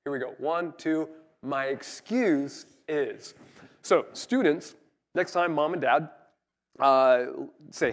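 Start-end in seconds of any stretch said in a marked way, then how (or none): 1.4-3.3 slow, drawn-out chant of the phrase; slow group recital. Shown as a demonstration. Congregation repeating the phrase together, slow chant. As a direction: say it together on the count. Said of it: "one more time just for emphasis".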